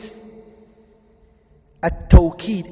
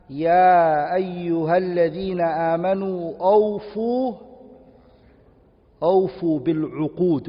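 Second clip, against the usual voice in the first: slow, drawn-out recitation of the verse; Quran recitation; much slower than usual. Acted. Teacher reciting the Quran verse slowly to students. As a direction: recite the ayah slowly and reverently. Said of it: "Allah used it in the Quran".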